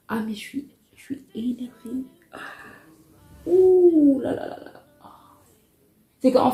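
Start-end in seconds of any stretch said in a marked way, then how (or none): 0.1-2.4 whispered, fed-up and seething; hushed whisper of real irritation; whispered annoyance. Real, not acted. 3.5-4.7 exasperated. Real, not acted. Overwhelmed by her own anger.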